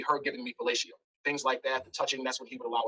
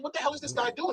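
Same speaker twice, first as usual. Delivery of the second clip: high-pitched exasperated question; retold annoyance; 11 semitones higher than usual. Man getting mad at a blocked view, retold exasperation. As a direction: act out getting mad at someone. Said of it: "So I'm getting mad".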